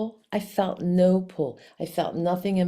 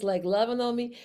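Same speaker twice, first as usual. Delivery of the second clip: ordinary talk, nothing marked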